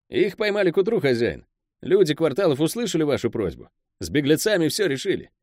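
high-pitched underling reporting to his boss; Pel, the deferential henchman. Acted.

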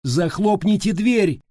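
loud, high, slow urgent shout; drawn-out high yell of command. A narrator's reading. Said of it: "крикнула Стеф".

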